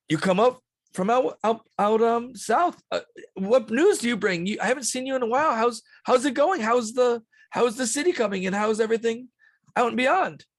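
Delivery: high, eager string of questions; chatty camp head. Acted. Stanley asks Trevor for news.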